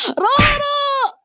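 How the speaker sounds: long, very high, loud drawn-out squeal; excited shriek. Acted. Gamer picking her favourite celebrity skin, ecstatic shriek. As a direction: shriek the name, overjoyed.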